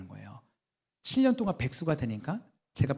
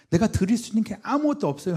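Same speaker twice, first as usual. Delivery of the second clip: crying out louder; 7 semitones higher, 6 dB louder than usual. Retold or imitated. Confessing helplessness to God.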